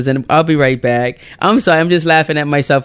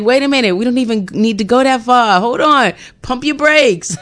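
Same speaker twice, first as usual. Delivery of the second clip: urgent stopping; 6 semitones higher than usual. Retold or imitated. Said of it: "It's like".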